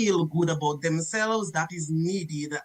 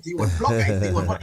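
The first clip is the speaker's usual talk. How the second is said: lower, louder mocking questions; mock deep questioning; 10 semitones lower, 5 dB louder, faster than usual. Waitress pestering customer, low and louder.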